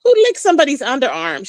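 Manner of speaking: ordinary talk, nothing marked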